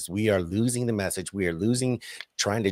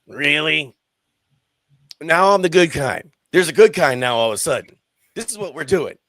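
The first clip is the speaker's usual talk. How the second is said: loud, indignant disbelief, retold; mock-amazed sarcastic retort; retold incredulous comeback; 3 semitones higher, 6 dB louder than usual. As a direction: throw the words back at them, loudly.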